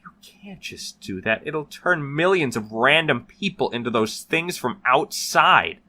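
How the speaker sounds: high, loud desperate shout. Acted. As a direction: yell it in alarm. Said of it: "Wojak shouted".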